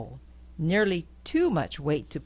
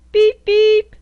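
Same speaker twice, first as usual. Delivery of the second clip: much higher, loud tinny beeps; high squeaky beep; 15 dB louder than usual. Acted. Reading to a child, beeping high and loud like a horn.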